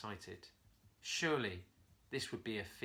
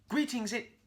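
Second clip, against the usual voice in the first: hippo voice; 12 semitones higher, 6 dB louder than usual. Acted. Hippo greets monkey.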